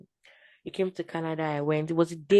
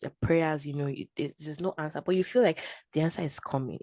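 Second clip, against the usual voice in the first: ordinary talk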